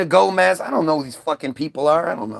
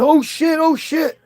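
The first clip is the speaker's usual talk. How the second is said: panicked oh shit; 10 semitones higher, 3 dB louder, slower than usual. Retold or imitated. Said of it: "And you're".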